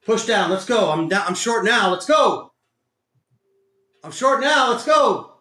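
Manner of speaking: real keyed-up yelling; urgent urging. Real, not acted. Urging the market down.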